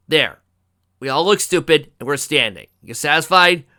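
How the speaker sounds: loud, irritated giving in; exasperated. A narrator's reading. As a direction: grumble loudly at being pushed into it. Exasperated parody line, loud.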